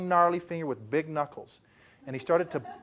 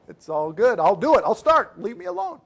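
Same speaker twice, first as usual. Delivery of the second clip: louder, rising to a high plea; just get away from me already; mock-panicked give-in; exasperated brush-off; 4 semitones higher, 5 dB louder than usual. Speaker brushing off pushy seller.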